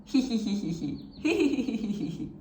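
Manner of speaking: light demo giggle of hee sounds; mock giggle. Shown as a demonstration. Teacher showing students a laughing hee hee. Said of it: "Can you try?".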